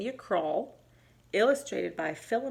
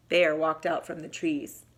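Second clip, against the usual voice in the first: ordinary delivery, nothing marked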